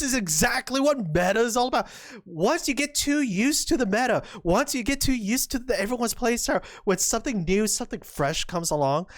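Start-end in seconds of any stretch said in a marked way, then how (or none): none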